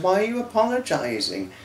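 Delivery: a light take on Michelle's voice; imitating Michelle. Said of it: "I think she said".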